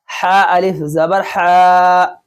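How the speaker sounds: final "हा" held long and level; drawn-out vowel. Shown as a demonstration. Teacher showing the one letter to stretch, drawn-out vowel. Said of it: "बस हा को खींचना है".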